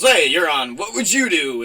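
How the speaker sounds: mock a host springing the surprise, loudly; TV host ambush. John Quinones walks out on bullied actors, loud host announcement. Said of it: "and he goes".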